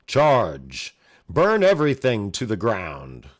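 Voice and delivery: hammed-up sophisticated villain shouting charge. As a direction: bellow the order like a haughty warlord. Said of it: "the sophisticated voice".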